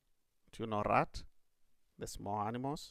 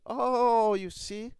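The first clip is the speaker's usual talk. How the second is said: higher, louder sheepish rat; caught rat; 11 semitones higher, 7 dB louder, slower than usual. Acted. Rat caught lying by the rabbit, sheepish. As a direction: admit defeat with an embarrassed oh.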